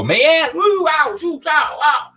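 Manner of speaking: high, loud whooping shout-out; excited cheer. Real, not acted. Host hyping a viewer's comment, high whooping cheer.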